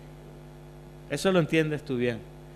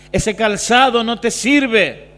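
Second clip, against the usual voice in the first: emphatic exhortation; 4 semitones higher, 7 dB louder, slower than usual. Retold or imitated. Pastor exhorting the young man.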